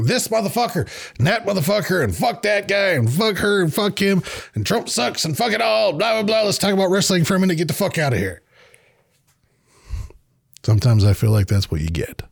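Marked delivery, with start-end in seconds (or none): 0.0-8.4 mock angry rant. Host imitating himself getting poked into ranting, high mock hollering. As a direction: rattle off a worked-up, high-voiced tirade. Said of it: "hollering and shit and being mad".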